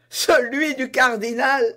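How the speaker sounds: high, loud laughing exclamation; bursting with laughter. Acted. D'Artagnan recognizing the cardinal's purse, laughing loudly. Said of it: "s'écria en éclatant de rire D'Artagnan".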